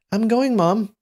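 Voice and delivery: put-on very high, childlike boy's voice; high young voice. A narrator's reading. Young man calling to his mother as he leaves, high voice. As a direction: pitch it way up like a little boy. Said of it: "say in a high voice".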